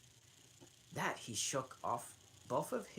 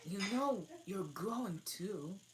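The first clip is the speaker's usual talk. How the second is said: bolder Alice; 9 semitones higher, 3 dB louder than usual. Acted. Alice scolding the dormouse, bolder and higher. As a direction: push back a little bolder. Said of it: "said Alice more boldly".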